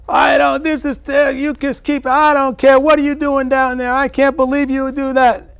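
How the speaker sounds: high, loud furious yelling down from the window; screaming at him from above; angry yell. Retold or imitated. Mother yelling at father from the third-floor window, furious.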